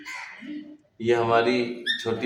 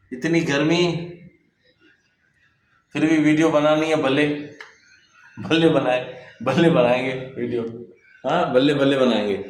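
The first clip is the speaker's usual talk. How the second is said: louder, higher, fired-up griping; worked-up defiance; 5 semitones higher, 4 dB louder than usual. Real, not acted. Vlogger griping about heat but vowing to film, fired up. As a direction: push through the heat, defiant.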